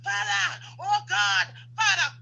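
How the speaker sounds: imitating a parent crying out to God; mock crying. Mocking parents who wail to God over their children. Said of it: "Then you're crying".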